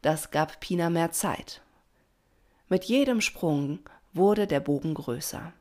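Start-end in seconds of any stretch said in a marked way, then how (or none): none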